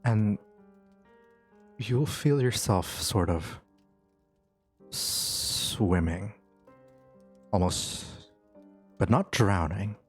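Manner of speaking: slow, hushed scene narration; game master's scene setting. Acted. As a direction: draw them into a dreamlike scene. GM sets the dream scene.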